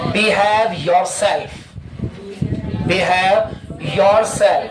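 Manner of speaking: very slow, every syllable laid out; slow model sentence. Shown as a demonstration. Teacher showing students the phrase, very slowly. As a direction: draw each word out for the class.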